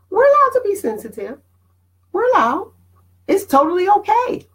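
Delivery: lifted high, insisting it's fine; high emphatic reassurance. Real, not acted. Host tells sensitive viewers it's okay, high and emphatic. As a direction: raise the voice high and affirm.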